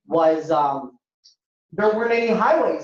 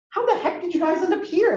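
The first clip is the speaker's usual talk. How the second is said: amazed; 12 semitones higher than usual. Retold or imitated. Traveler asking the elderly mosque caretaker, amazed. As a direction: pitch leaps high, full of wonder.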